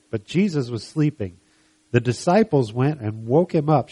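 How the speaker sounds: emphatic Bible story narration; dramatic scripture reading. Acted. Preacher telling of Jesus asleep in the storm, dramatic reading. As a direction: read the storm story out with weight.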